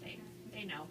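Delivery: hushed aside; whispering. Real, not acted. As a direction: drop to a whisper. Confiding aside about customers.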